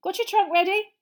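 high, loud, cheery question; brightly asking. Acted. Mister Weasley collecting Harry, bright and eager. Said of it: "said Mister Weasley brightly".

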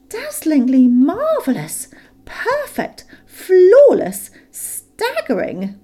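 high, loud, slow gushing praise; gushing exclamation. A narrator's reading. Bilbo flattering Smaug, high, loud and gushing. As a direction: overdo the admiration. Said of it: "exclaimed Bilbo aloud".

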